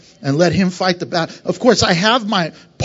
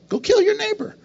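much higher, mock-outraged order; mock command; 12 semitones higher, 3 dB quieter than usual. Pastor mocking a literal reading of the law, very high. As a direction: squeak out an absurd command in mock alarm. Absurd Old Testament command.

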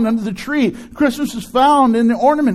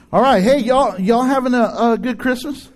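ordinary talk, nothing marked

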